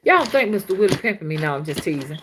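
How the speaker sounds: ordinary talk, nothing marked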